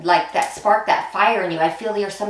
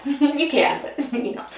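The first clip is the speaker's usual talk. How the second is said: laughing; 6 semitones higher than usual. Real, not acted. Laughing at own lisp joke.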